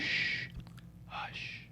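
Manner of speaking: hushed whisper. Pretend. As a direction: breathe it like a secret. Saying the villain name Hush.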